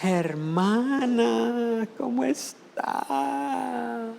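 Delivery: high, slow, sugary soft greeting; hypocrite's syrupy church voice; mock sweet churchgoer. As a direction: put on a fake-pious sweetness. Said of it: "cuando está en la iglesia".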